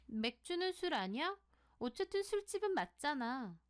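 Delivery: high-pitched, quarrelsome comeback; argumentative retort. Acted. Sohee argues with her friend about the bar job, quarrelsome.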